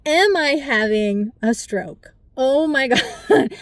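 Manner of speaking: alarmed question rising into a startled laugh; replaying the moment fear hit; retold panic. To her listeners, recalling her stroke scare, alarmed.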